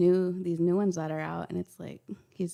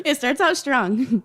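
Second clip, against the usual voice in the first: laughing; 7 semitones higher, 5 dB louder, slower than usual. Real, not acted. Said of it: "it starts with a cuss word".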